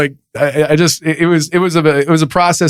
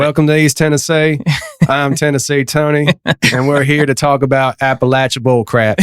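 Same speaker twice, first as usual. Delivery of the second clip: Southern show-host intro with twang; put-on Southern accent. Shown as a demonstration. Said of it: "That was pretty good".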